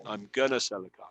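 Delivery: I'm gonna sell the car, gonna said as one quick word; informal gonna. Shown as a demonstration. Teacher modelling the casual form for the class. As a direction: drop going to into a casual gonna.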